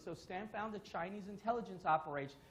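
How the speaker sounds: ordinary talk, nothing marked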